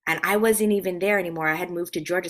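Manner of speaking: ordinary delivery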